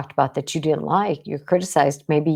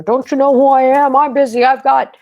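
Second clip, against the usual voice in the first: loud, high demanding huff at the counter; entitled outburst; 7 semitones higher, 7 dB louder than usual. Retold or imitated. Patient at the doctor's office demanding attention, indignant and loud.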